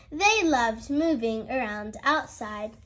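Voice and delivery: plain reading voice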